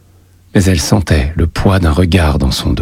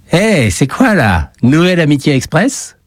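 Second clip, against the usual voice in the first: mocking call-out; 9 semitones higher than usual. A narrator's reading. A boy interrupts two new friends in the schoolyard, mocking call-out. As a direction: call out in a higher voice, ringing across the yard. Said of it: "qu'une voix retentit".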